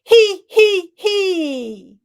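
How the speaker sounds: high, loud hee hee hee; a bright giggling laugh pushed up high; high giggle. Shown as a demonstration. Coach demonstrating a high hee hee to the class. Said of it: "put our hands in our lower lungs".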